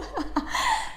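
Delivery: airy laugh; high breathy giggle. Real, not acted. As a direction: giggle at yourself. Laughs after grandma voice.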